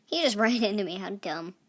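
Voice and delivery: ordinary delivery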